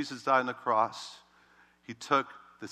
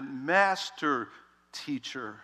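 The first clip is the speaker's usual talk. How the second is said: ordinary talk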